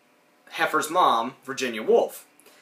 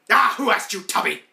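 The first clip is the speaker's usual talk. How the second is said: higher, louder sneering cartoon voice; dismissive jab; 7 semitones higher, 4 dB louder than usual. Ed sneering at Heifer, higher dismissive jab. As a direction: brush the kid off.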